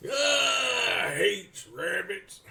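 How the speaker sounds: very slow, high cartoon cowboy drawl; Yosemite Sam. Imitating a cartoon character who hates rabbits, drawn-out cowboy voice. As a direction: stretch every word like an ornery cartoon gunslinger. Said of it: "Name's Yosemite Sam".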